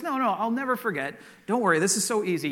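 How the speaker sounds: acted overconfident husband; insistent reassurance. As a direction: brush off the worry with breezy insistence. Joke husband brushes off wife's worry.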